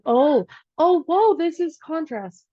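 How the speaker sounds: acted-out astonished discovery; sudden realization. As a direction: exclaim as it dawns, then slow down.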